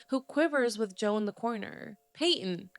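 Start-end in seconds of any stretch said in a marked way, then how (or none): none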